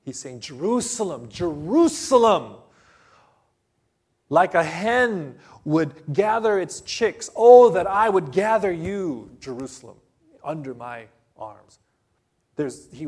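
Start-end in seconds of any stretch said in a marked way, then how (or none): 0.4-11.8 lamenting cry. Retold or imitated. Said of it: "when Jesus was weeping".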